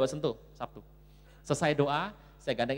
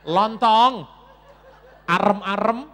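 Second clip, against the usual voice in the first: loud, raised comic protest; mocking outburst; 4 semitones higher, 13 dB louder, much slower than usual. Preacher ridiculing wife's tight-clothes advice, loud mocking shout. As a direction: belt out each food name in ridicule.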